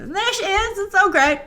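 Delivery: high, delighted reveal; pleased to be rewarded for watching closely; excited spotting. Real, not acted. Spotting her again onscreen.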